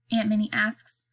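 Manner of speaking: plain reading voice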